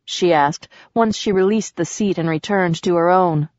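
plain reading voice

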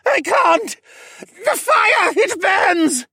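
very high, loud, agonized cries; howling in pain. A narrator's reading. Assassin burned by fire magic, howling in pain. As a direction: cry out in burning agony. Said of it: "Dolian howled in pain".

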